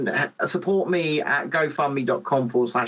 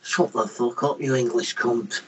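mock hostile Scots, lower; lightly put-on broad Scottish accent; broad Scottish kid; 3 semitones lower than usual. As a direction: growl it like a tough Glasgow kid. Voicing broad Scottish schoolkids.

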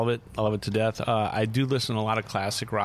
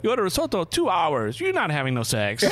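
put-on lightly Italian-accented, high and loud explanation; the Italian friend explains the risotto rule, high and loud; 9 semitones higher, 3 dB louder than usual. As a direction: explain like an Italian uncle sharing the secret. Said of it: "He goes".

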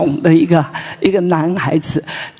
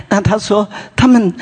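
ordinary talk, nothing marked

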